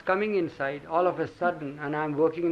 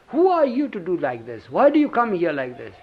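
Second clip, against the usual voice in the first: peeved angry demand; 3 semitones higher, 9 dB louder than usual. Retold or imitated. Father confronting the strange old man, loud and angry. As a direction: raise the voice and confront the intruder. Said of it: "my father all the more got peeved".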